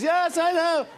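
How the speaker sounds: very high, loud comic impression; Jerry Seinfeld. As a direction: exclaim it way up high, exaggerated. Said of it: "He does".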